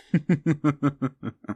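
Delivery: high, delighted villain laugh; a mocking cackle at the billionaire; gleeful laugh. Demon mocks the billionaire.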